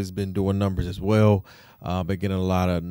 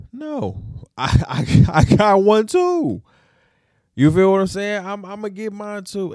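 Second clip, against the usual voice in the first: mock retort; 13 semitones higher, 9 dB louder than usual. Husband retorts in the retold scene.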